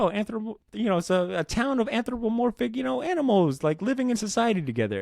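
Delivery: high, mock-innocent lilt; cutesy delight. Reader first meeting a deceivingly cute book, high cutesy voice. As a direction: pitch it way up as if charmed by something cute.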